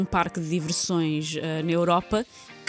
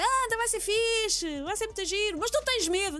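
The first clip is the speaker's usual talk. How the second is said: coaxing friend; 8 semitones higher than usual. Retold or imitated. Friends coaxing a reluctant rider.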